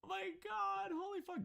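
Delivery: floored by what he just heard; shocked disbelief. Real, not acted. Streamer reacting in disbelief, high.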